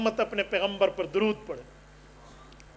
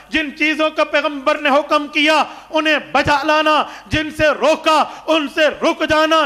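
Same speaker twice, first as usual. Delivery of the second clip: forceful raised-voice exhortation; impassioned preaching; 6 semitones higher, 8 dB louder than usual. Real, not acted. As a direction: ring out each phrase to the congregation.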